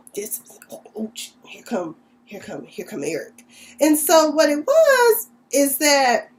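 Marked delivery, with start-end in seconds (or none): 0.1-3.4 hushed whispered warning. Retold or imitated. Patrick warns Neil that Eric is coming, in a whisper. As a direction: whisper it so Eric won't hear.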